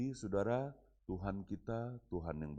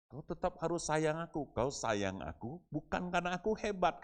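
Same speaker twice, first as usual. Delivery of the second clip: a retold father pleading with his son in a raised voice; higher, urgent, imploring his son; earnest father pleading; 10 semitones higher than usual. Pastor retelling his talk with his son, as an earnest father.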